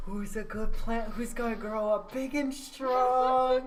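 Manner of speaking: put-on high, slow baby talk to a plant. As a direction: coo at it like a pet. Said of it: "we're like".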